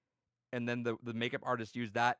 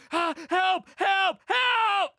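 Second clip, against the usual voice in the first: very high, loud mock screams; imitated screaming; 11 dB louder, much slower than usual. Actor being dragged into a van, screaming for help. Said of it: "he's just screaming his ass off".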